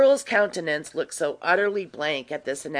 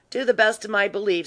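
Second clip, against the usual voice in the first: ordinary delivery, nothing marked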